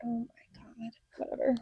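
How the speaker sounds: whispered, half-annoyed reply; resigned whisper. Retold or imitated. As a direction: breathe it out like giving up. Her own reply acted out.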